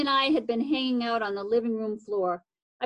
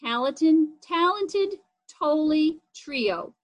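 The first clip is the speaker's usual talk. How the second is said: slow, drawn-out talented Tolley trio; playful alliteration; 4 semitones higher, 3 dB louder, slower than usual. Shown as a demonstration. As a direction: savor each word of talented Tolley trio slowly. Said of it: "I laughed as I realized".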